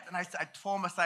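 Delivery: ordinary talk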